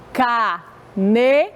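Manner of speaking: "Caneta" split by pauses into ca, ne, ta; syllable by syllable. Shown as a demonstration. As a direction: open up for each syllable of "Caneta", slowly. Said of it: "Contando a quantidade de vezes".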